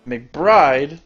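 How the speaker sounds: loud, startled question; shocked exclamation. Acted. Hero discovers who the traitor is, loud shocked exclamation. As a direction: burst out with the name in disbelief.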